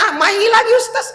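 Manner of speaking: put-on high pleading child voice; lightly voiced whiny kid; whining child. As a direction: beg like a spoiled kid for the phone.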